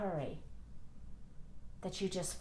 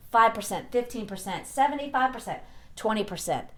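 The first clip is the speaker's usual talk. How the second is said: loud, rising mock diet chant; mocking rant; 3 semitones higher, 8 dB louder than usual. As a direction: rattle off the conflicting advice mockingly. Mocking conflicting diet advice.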